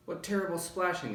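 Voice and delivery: ordinary delivery, nothing marked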